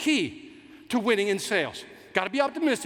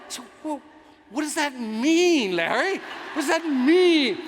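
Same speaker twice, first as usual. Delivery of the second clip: baffled plea; 6 semitones higher, 4 dB louder, slower than usual. Retold or imitated. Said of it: "I said".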